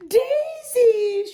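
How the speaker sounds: very high, loud happy shout of a name. A narrator's reading. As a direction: call out joyfully to a friend. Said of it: "shouted Hannah".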